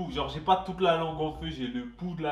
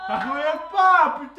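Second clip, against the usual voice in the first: laughing hard; 11 semitones higher, 8 dB louder than usual. Real, not acted. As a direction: squeal it while laughing. Stuck candy, laughing hard.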